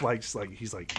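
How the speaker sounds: ordinary delivery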